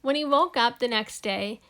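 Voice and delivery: ordinary delivery, nothing marked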